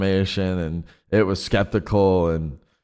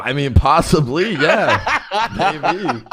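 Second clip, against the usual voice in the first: high, louder, breaking into laughter; laughing admission; 7 semitones higher, 4 dB louder, much slower than usual. Real, not acted. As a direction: concede it through a laugh. Laughs while conceding a teasing accusation.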